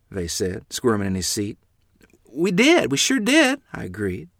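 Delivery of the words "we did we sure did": high, nervous, shaky reply; a scared boy rushing to agree; trembling in fear. Acted. Boys caught by the grandfather, frightened. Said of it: "my voice trembling in fear".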